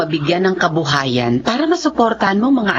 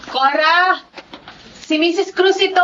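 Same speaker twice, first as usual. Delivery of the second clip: high voice calling from outside the door; 10 semitones higher than usual. Acted. The social worker calls to Cora from outside, calling out. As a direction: call through the door to be heard.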